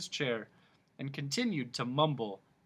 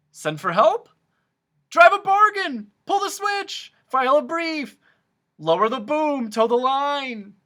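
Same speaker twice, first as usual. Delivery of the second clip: loud, high rapid-fire cries; hammed-up frantic humbug; frantic suggestions; 14 semitones higher, 13 dB louder than usual. A narrator's reading. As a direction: blurt every idea at once. Humbug blurting ideas.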